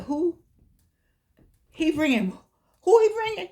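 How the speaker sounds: high, incredulous mock gossip; nosy relative. Gossiping relative at the reunion, high and incredulous. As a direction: squeal it like a nosy auntie.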